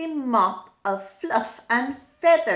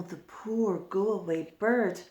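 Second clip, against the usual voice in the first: plain reading voice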